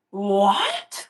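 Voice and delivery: loud, drawn-out incredulous "what"; retold disbelief. Vlogger retelling her reply to olive-hating friend, incredulous. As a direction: react in mock outrage to a friend's confession. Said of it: "I was actually mad".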